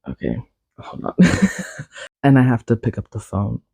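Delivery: ordinary delivery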